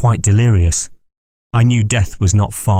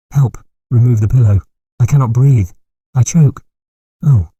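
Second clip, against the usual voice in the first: choking panic; 3 semitones higher than usual. Acted. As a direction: fight for breath in terror. Dying man suffocating in delirium.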